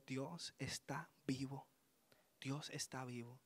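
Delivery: soft, slow and reverent, almost breathless; quiet awestruck retelling; awed hush. Retold or imitated. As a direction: say it hushed, as if still overwhelmed. Awed words to mother after retreat.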